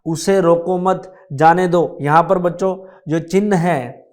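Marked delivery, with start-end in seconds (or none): 0.0-2.0 "उसे रोको मत", short break after मत, then "जाने दो"; phrase it as उसे रोको मत / जाने दो; pause after मत. Shown as a demonstration. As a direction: run रोको मत together, then stop before जाने दो. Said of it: "यदि ये मत के बाद लगेगा".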